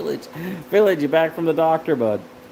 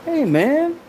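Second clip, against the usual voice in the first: high playful greeting; 4 dB louder than usual. Acted. Teasing greeting to friend.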